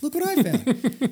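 cheery sing-song to his wife at the door; excited reveal. Retold or imitated. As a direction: show off the find gleefully. Showing wife the yowling kitten.

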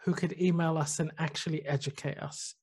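usual speaking voice

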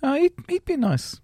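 admiring thought. Pretend.

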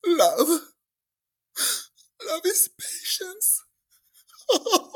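very high, gasping mock sobs between words; hammed-up weeping through a poem reading, slowly; imitated sobbing. Crying friend reading poem at church, mock sobbing. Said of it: "and she's crying".

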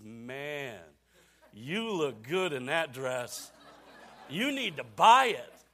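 drawn-out, flattering devil voice; tempting devil. Acted. Devil flatters wife in the dress shop, playful tempting. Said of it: "just like the devil".

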